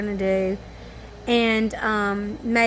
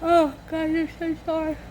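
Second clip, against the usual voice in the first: apology squeezed out through a yawn, pitched up; 6 semitones higher than usual. Real, not acted. Tired mom apologizing to viewers mid-yawn. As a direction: talk through the tail of a big yawn. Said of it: "I'm tired".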